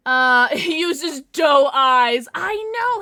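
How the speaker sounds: loud, slow laughing exclamation. Real, not acted. Streamer laughing loudly about her dog's doe eyes.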